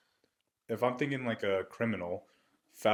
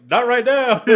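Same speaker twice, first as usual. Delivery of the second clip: very high, loud playful "Not right now"; joking loud refusal; 16 dB louder than usual. Retold or imitated. Off-duty officer refusing his brother, loud playful shout. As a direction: shout the excuse for a laugh.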